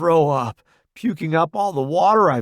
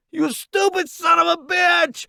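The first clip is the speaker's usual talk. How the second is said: high, strained shout; furious yell; 3 dB louder than usual. A narrator's reading. Roddy tackling newbie out of gunfire, furious yell. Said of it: "Roddy yells as he tackles me".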